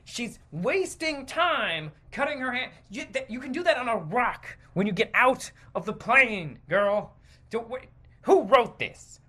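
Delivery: ordinary talk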